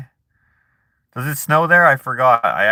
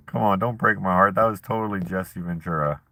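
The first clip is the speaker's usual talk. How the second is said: ordinary talk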